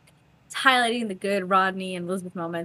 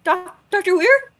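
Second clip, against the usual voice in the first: timid question; 9 semitones higher, 8 dB louder than usual. Retold or imitated. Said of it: "they're like".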